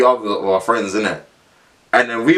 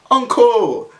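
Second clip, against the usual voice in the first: mocking call; 11 semitones higher, 3 dB louder than usual. Kids taunting the caretaker.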